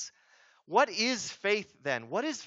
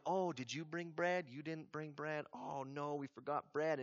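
mock fretting; 2 semitones lower, 11 dB quieter than usual. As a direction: imitate disciples fussing under their breath. Disciples fretting over forgotten bread.

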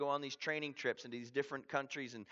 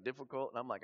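normal talking voice

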